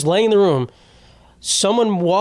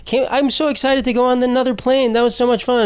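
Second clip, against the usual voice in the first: put-on high, excited small-boy voice; imitated happy child, pitched way up; cute little kid; 9 semitones higher than usual. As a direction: gush like a sweet child to Daddy. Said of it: "he was so cute at the end".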